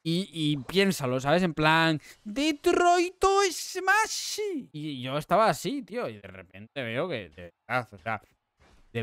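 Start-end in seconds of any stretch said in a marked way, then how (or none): 2.2-4.4 high, drawn-out battle cry; All Might. Retold or imitated. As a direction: bellow a superhero's attack name, stretching it out. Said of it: "Como dijo el Might".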